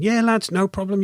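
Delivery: breezy retold go-ahead, pitch lifted; lightly voiced pilot. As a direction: imitate a pilot happily saying yes. Pilot's friendly yes, acted out.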